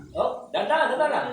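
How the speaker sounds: loud, high call to go easy; urgent caution. Real, not acted. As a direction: call out a caution to the walking patient. Therapist cautioning walking patient.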